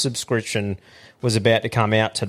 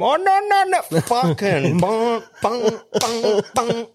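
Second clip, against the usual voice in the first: high, hammed-up wailing scammer voice; mock pleading scammer; 10 semitones higher, slower than usual. Mate voicing a flogged scammer, high mock whimpering. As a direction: play the scammer begging for mercy, pitched up.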